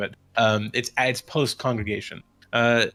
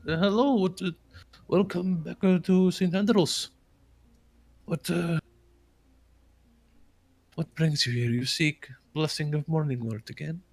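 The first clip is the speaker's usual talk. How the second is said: put-on higher-pitched, accented village priest; courteous priest; 7 semitones higher than usual. Priest welcomes visitors back to Saint Andrews, higher accented voice. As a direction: greet them as a welcoming old-world cleric.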